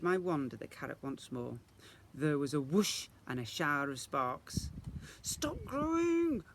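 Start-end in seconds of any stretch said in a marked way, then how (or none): 5.2-6.4 high, slow inner plea; thought. Acted. Isadora thinking at the carrot, slow pleading. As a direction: plead slowly in your head. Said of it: "I thought".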